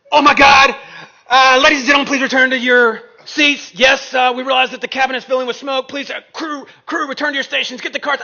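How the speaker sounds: mock panicked cabin announcement, high and loud; freaking-out stewardess. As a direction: shout frantic orders over the plane's radio. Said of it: "They are freaking out".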